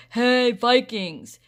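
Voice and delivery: long, loud drawn-out yell. A narrator's reading. Rusty yelling at the hidden club. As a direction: call across the woods at full volume, slowly. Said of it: "they heard Rusty yell".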